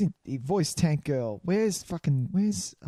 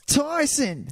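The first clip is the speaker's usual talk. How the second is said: excited; 11 semitones higher than usual. Real, not acted. Discovers the voice match.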